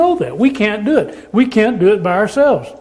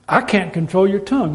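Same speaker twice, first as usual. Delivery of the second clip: usual speaking voice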